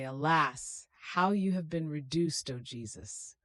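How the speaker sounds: usual speaking voice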